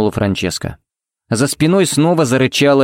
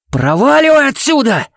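much higher, loud furious yell; 3 dB louder than usual. A narrator's reading. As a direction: scream at him to get out. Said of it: "проорал Кевин". (яростный крик)

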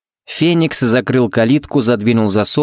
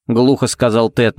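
ordinary delivery, nothing marked

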